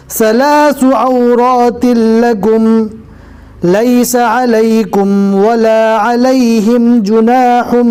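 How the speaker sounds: slow melodic tajweed chant; Quran recitation. Shown as a demonstration. Teacher reciting verses to the class, melodic and measured. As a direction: recite it, drawing out each verse.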